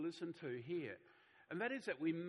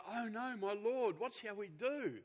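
frightened servant; 5 semitones higher, 4 dB louder, slower than usual. Acted. Servant panics at the army.

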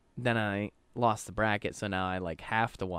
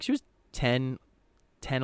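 ordinary delivery

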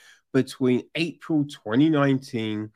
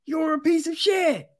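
high-pitched contemptuous put-down; disgusted condemnation; scornful verdict; 13 semitones higher than usual. Real, not acted. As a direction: spit out the insult. Repeats condemnation.

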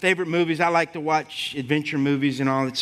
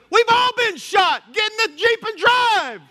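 high, loud shouted order; sergeant's shout; 5 dB louder than usual. Retold or imitated. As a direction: yell it like a sergeant under fire. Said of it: "he looks at him and goes".

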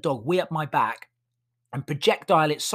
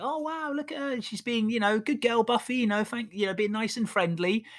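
much higher, sing-song doting owner voice; cooing at the dog; 7 semitones higher than usual. Retold or imitated. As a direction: coo over the puppy like a proud owner. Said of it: "Nicole was like".

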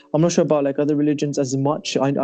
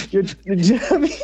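higher, words breaking into laughter; real laughing; faster than usual. Real, not acted. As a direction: crack up while trying to finish.

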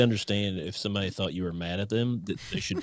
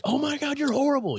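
much higher, squealing outrage; mock irate shriek. Teammate imagining an irate player yelling at the umpire. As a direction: shriek it in fake fury.

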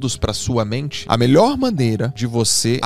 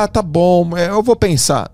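hesitant person imitation; 6 semitones higher, 3 dB louder, slower than usual. Hesitant person voiced in example.

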